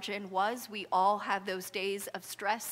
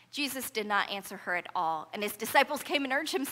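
normal talking voice

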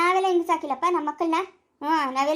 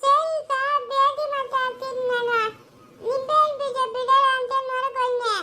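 put-on slow, much higher childlike voice; small child voice; 7 semitones higher, slower than usual. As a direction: answer back like a little kid, slowly. Child answering scolding adult.

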